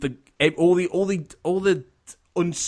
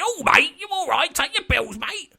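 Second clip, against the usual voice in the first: mock Cockney geezer, pitched way up; hammed-up Cockney old man; Cockney accent. Podcaster mocking a Cockney character, high put-on Cockney. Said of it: "one with a weird Cockney accent going".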